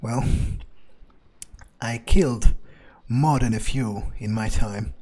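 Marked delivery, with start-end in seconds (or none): none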